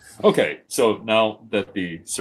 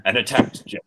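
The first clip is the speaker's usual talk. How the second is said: ordinary delivery, nothing marked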